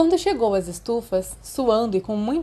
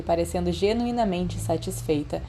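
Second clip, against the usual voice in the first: plain reading voice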